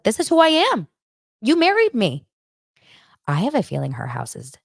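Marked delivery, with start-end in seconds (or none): 0.0-2.3 mock Tori.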